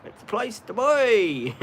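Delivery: put-on lightly done Italian-Australian accent. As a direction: imitate a cousin with a thick Italian accent. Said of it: "thick Italian accent".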